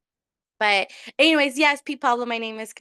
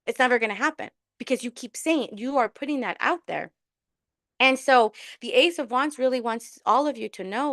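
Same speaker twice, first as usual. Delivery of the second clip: ordinary talk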